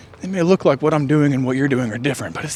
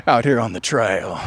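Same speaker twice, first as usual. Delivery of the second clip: ordinary talk, nothing marked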